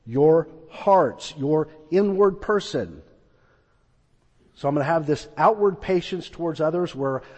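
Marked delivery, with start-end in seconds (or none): 0.0-3.0 slow scripture quote. Shown as a demonstration. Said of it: "He says".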